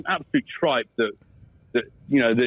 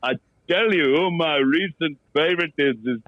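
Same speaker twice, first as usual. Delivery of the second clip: Pommy Mbango impression; slower than usual. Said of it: "Pommy Mbango".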